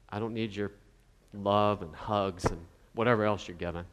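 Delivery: low, soft, smug brush-off; smug dismissive voice. Retold or imitated. Preacher voicing smug townspeople who refuse help, low and dismissive. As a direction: act out a self-satisfied person waving off help.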